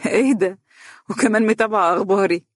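teasing delight, on the edge of a laugh; amused, near laughter. A narrator's reading. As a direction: ask it through a broad smile. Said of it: "اتسعت ابتسامته وكاد أن يضحك".

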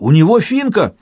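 high, alarmed call; old man's high warning cry; cried warning. A narrator's reading. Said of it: "закричал старичок".

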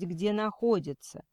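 usual speaking voice

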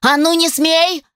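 high, drawn-out warning cry; angry yell. A narrator's reading. Pregnant woman warning the creature off her belly, yelling. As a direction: cry out to stop him. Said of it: "заорала она".

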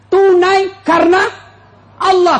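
high, loud and slow on tunai karena Allah; preaching emphasis. Acted. Preacher stresses the marriage vow.

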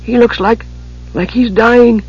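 high, faltering, frightened kender; afraid for friend. A narrator's reading. Tass watching his young friend suffer, afraid. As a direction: break off, then say it fearfully high. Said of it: "suddenly afraid for his young friend".

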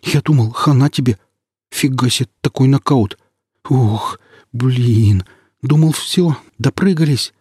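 high breathless rush of relief; excited relief. Acted. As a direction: gush with shaky relief. Companion's relief after knockout.

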